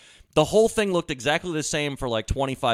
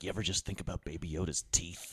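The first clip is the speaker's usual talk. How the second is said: deadpan quiet; 9 semitones lower, 13 dB quieter than usual. Retold or imitated. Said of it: "She's like".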